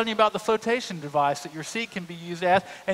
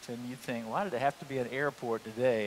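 soft, hushed private worry; worried inner thought; 6 semitones lower, 8 dB quieter than usual. Retold or imitated. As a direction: mutter it to yourself, uneasy. Anxious inner thought at newsstand.

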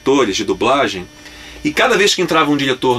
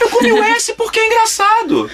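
laughing. Real, not acted. Laughing defence to the director.

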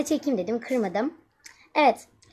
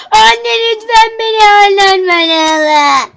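higher, loud wailing sing-song; mock crying plea; 7 semitones higher, 12 dB louder than usual. Mother mocking the daughter's tearful begging, high wail. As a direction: mimic a child begging through tears. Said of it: "diye yanıma gelirsen".

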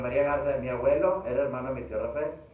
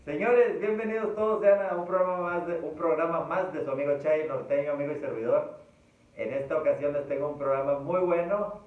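show host intro; 3 semitones higher than usual. Real, not acted. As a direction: open the show like a presenter. Host opens the programme.

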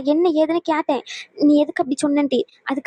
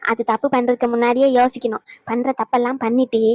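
ordinary talk, nothing marked

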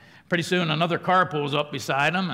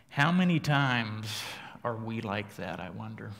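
normal talking voice

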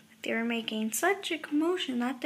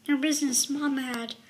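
ordinary delivery, nothing marked

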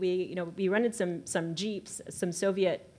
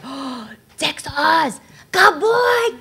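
much higher, louder, drawn-out imitation; imitating an excited Mongolian man recognizing Texas; excited Mongolian horseman; 9 semitones higher, 11 dB louder, much slower than usual. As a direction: mimic the thrilled old man hearing Texas.

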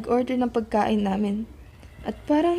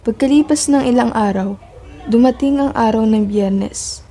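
louder story narration, building suspense; dramatic narration; 8 dB louder than usual. A narrator's reading. Narrator setting the night scene, loud and dramatic. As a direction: tell it like a thriller voiceover.

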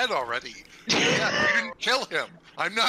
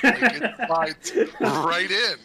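slow, laughing mock threat; laughing menace; 3 semitones higher, 5 dB louder, slower than usual. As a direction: gloat about the knife, laughing. Playful menace about knife.

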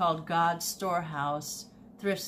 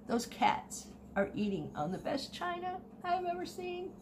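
incredulous; 12 semitones higher, 5 dB quieter than usual. Retold or imitated. Astonished retold remark.

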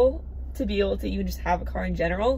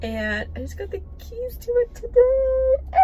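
tearful; 3 dB louder than usual. Real, not acted. Overwhelmed getting dream car.

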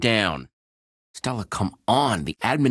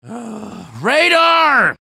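sigh, then a much higher, louder yell; exasperated shout; 11 semitones higher, 6 dB louder than usual. Acted. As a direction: yell his name in frustration. Calling a friend who ignores her.

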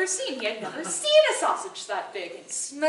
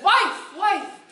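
loud, high call; 7 semitones higher, 7 dB louder than usual. Acted. Husband bursting in calling his wife, loud. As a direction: call her from the doorway.

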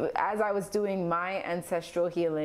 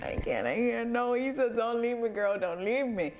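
mock pleading; 4 semitones higher than usual.

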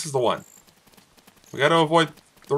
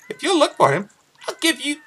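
much higher put-on farm girl voice; lightly voiced girl, higher pitched; Malon voice; 13 semitones higher than usual. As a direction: read her lines like a sweet young girl. Voicing Malon's dialogue.

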